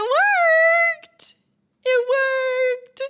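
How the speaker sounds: excited. Real, not acted. Code finally runs.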